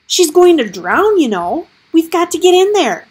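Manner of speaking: high, loud desperate plea; desperate shout. Acted. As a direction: plead loudly for rescue. Gregor desperate about drowning rat.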